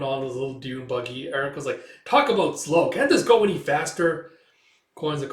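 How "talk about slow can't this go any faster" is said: louder, higher, exasperated; impatient complaint. Retold or imitated. Erica on the dune buggy, impatient complaint. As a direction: act out a fed-up passenger.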